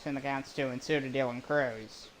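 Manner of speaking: brisk announcer call of the play. Acted. Streamer calling his own video game, play-by-play voice. Calls full count.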